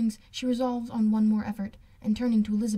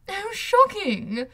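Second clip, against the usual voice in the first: much higher, louder, slow mock outrage; hammed-up scandalised gasp of words; shocked cry; 11 semitones higher, 5 dB louder, much slower than usual. A narrator's reading. Miss Bingley feigning outrage at Darcy's speech, loud and shrill-pitched. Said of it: "cried Miss Bingley".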